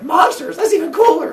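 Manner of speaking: put-on high, loud, thrilled teenager; thrilled teen. Teacher voicing Phaethon thrilled by monsters, high and eager. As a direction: gush like a kid hearing about monsters. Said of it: "And Phaethon's like".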